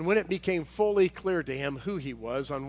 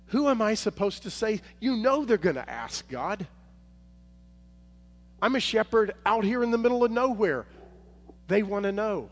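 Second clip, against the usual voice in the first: higher and louder, urgent plea; loud pleading Moses; 7 semitones higher, 5 dB louder than usual. Acted. Moses begs God for a name, loud and urgent. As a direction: cry out the question at God. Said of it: "And he says".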